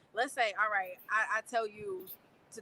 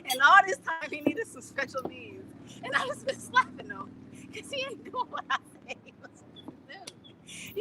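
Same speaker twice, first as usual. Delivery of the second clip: high, helpless laughter through the words; laughing so hard it nearly breaks; 8 semitones higher than usual. Real, not acted. Laughing at the idea of slapping her child, high.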